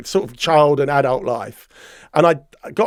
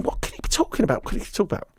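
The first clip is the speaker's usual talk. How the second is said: hushed, higher, half-voiced wondering; puzzled inner thought; 6 semitones higher, 8 dB quieter, faster than usual. Suspect privately wondering about the accusation, puzzled hushed thought. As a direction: think aloud under your breath, baffled. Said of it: "I'm thinking".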